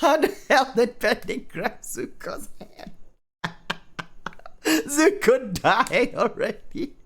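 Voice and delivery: high squeaky words through real laughter; high-pitched, disbelieving laughter; incredulous laughing. Real, not acted. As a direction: ask it while helplessly cracking up. Reader cracks up at story error.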